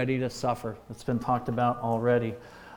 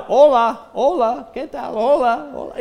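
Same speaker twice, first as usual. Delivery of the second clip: higher and louder, chirpy tourist greetings; mock eager beginner; 9 semitones higher, 6 dB louder than usual. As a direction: overdo the clueless beginner's cheerful hellos. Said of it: "as they come out of".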